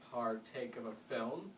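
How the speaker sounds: partake of a film said slowly, word by word; slow repeat. Acted. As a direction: spell it out slowly for someone who does not follow. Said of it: "Mister Crane repeated slowly".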